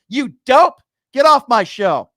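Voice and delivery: loud, high furious blast, then cut him off; angry shout. Real, not acted. Talk host shouting a caller off his show. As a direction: slam the caller off the air.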